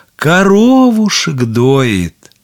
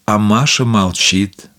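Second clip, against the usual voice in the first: ordinary delivery, nothing marked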